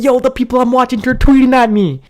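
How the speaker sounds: high, rushing fanboy excitement; freaking out. Retold or imitated. Fan telling his cousin the pros replied, freaking out. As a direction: gush in a high, amazed voice. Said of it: "I just started freaking out".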